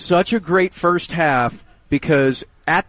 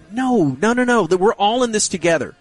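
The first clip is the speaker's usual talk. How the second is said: emphatic refusal; 7 semitones higher than usual. Retold or imitated. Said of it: "we're like".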